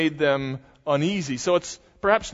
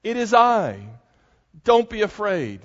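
commanding reassurance; 6 semitones higher, 4 dB louder than usual. Retold or imitated. Jesus calming frightened disciples.

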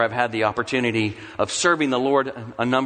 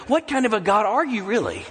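"What kind of a God are you really?" higher, pleading, falling at the end; pleading challenge; 7 semitones higher than usual. Acted. A desperate believer challenging God, "What kind of a God are you really?" higher and pleading. Climax of the voiced lament.

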